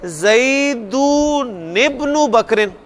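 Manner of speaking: "ज़ैदुन इब्नु" run together slowly as zaidu-nibnu; slow joined "ज़ैदुन इब्नु बकरिन", the n carried onto ibnu with an i; linked reading. Shown as a demonstration. Teacher demonstrating how to join the words, slow linked reading. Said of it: "तो हम इसको कैसे पढ़ेंगे?".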